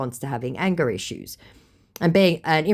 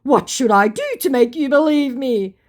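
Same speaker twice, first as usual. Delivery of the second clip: high, louder mock plea; pleading scammer; 10 semitones higher, 5 dB louder than usual. Vlogger mimics scammer pleading to be believed. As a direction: whine it like a cornered scammer. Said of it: "To which he responds".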